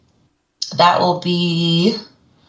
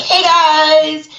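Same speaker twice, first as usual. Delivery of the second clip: bubbly greeting; 9 semitones higher than usual. Retold or imitated. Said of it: "you're just like".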